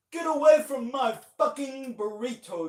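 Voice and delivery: loud, high attempt at an Australian accent, shouting; amateur Australian accent shout; mock Aussie yell. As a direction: yell it like an angry Aussie, badly imitated. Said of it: "I don't have a good Aussie".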